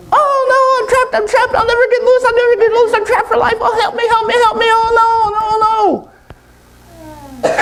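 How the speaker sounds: very high frantic monkey squeal; hammed-up panic in a squeaky voice; panicking monkey. Monkey trapped in the jar begs for help, panicked squeal.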